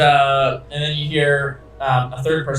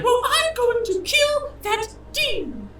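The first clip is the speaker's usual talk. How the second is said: very high, slow falsetto threat; vengeful wife; slower than usual. Acted. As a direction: vow revenge as a furious wife. Furious wife threatens her rival.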